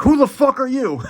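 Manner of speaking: high, loud startled cry; hammed-up high shocked shout; shocked morning-after yell. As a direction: recoil in mock horror. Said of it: "you're like".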